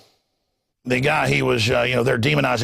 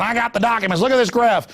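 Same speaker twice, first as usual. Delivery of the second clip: higher, insistent Perot; Ross Perot impression; 6 semitones higher than usual. Perot showing a graph at the debate, higher insistent. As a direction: push the documents eagerly. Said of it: "Ross Perot's going".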